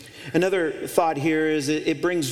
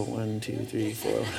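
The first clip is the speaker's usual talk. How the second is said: mock perfunctory counting; 5 semitones lower, 6 dB quieter, slower than usual. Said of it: "don't just turn to your spouse and go".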